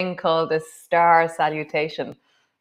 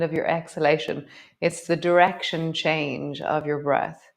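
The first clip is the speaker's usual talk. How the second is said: guided meditation. Acted. Yoga teacher guiding the class into breath, calm meditation voice. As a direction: settle them into the breath.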